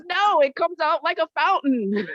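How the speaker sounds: defiant retort. Retold or imitated. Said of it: "I said".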